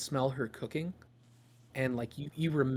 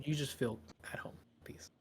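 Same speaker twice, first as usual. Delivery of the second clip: softer hushed calm; whispering; 7 dB quieter than usual. Acted. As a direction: whisper it gently. GM soothing, whispers.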